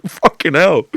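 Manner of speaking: high and loud, laughing in disbelief; shocked exclamation. Retold or imitated. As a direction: blurt it out, stunned by the slap. Retelling shock at the slap.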